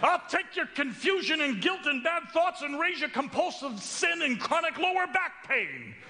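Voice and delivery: very high, scornful heckler's yell; yelling from the back. Acted. Man way back in the room topping the newcomer's list, high yell. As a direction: holler it across a big room, pitch way up. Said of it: "that yells out".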